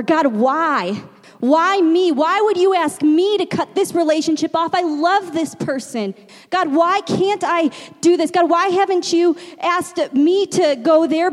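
acted wail of protest, pitch held high; anguished pleading. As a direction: plead with God, demanding to know why. Acted anguished questioning of God.

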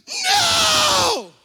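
sudden high, loud cry of no; shouted no. Retold or imitated. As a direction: yell it out in sudden panic. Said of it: "soft-spoken, goes".

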